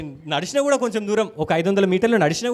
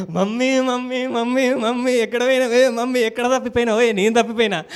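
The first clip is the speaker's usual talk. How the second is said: higher, louder retold cry for a lost mother; a lost child shouting for mummy, half laughing; calling out for mummy; 5 semitones higher, 6 dB louder than usual. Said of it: "నేను అరుస్తున్నా". (తల్లి కోసం గట్టిగా అరుపు)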